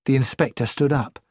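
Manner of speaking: usual speaking voice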